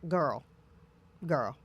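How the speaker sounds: put-on low, soft girlfriend banter; casual chat with a close friend. Talking to close girlfriends, low and casual. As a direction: drop the voice low and easy, just between girls. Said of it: "I can literally go like this".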